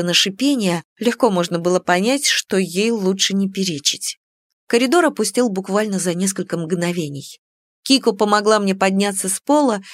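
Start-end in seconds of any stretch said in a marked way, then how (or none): none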